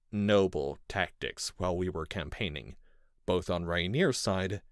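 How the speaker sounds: usual speaking voice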